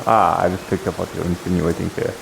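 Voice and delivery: ordinary talk, nothing marked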